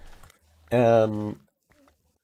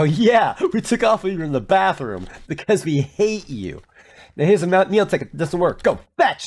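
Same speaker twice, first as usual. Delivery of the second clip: higher, dismissive mock staff voice; mocking airline staff; 7 semitones higher than usual. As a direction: sneer it like a rude clerk.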